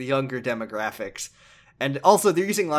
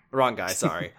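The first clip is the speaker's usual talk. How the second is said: ordinary talk, nothing marked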